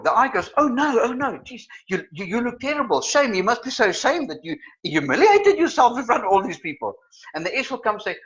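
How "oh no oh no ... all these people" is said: high, flustered sympathy act; mock fussing concern. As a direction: fuss dramatically over someone's embarrassment. Acting the I fussing over victim.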